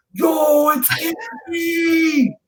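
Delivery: high excited yell across the street; big excited street shout; retold street shout. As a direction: call out the name like a hustler spotting a star. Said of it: "He's shouting".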